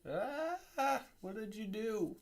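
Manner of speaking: high panicked squeak; alarmed cry. Pretend. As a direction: gasp at the mess you made. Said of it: "Rather than right now where it's like".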